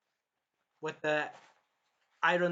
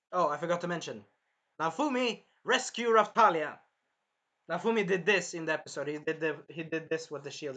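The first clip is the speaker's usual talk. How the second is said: ordinary talk, nothing marked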